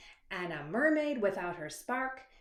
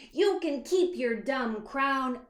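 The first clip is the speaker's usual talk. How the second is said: louder, higher mermaid shout; 5 semitones higher, 7 dB louder than usual. Acted. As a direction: yell it down the pit. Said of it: "She shouted into the pit".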